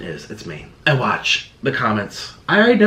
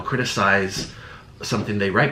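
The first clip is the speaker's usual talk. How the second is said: ordinary talk, nothing marked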